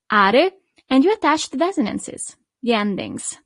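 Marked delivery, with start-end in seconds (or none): none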